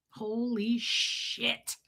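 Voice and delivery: very slow, soft amazement; softly savouring a jaw-dropping find; hushed awe. Real, not acted. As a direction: stretch it out under the breath. Awed reaction to a store find.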